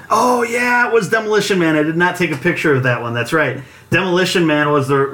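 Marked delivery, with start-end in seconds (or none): 0.1-1.8 sudden realization. Real, not acted. Suddenly remembering the machine.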